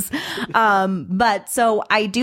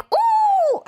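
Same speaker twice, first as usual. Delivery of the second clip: very high "ooh" yelp; high-pitched mock Irish holler; high stage-Irish whoop. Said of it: "a lot of just, like, yelling".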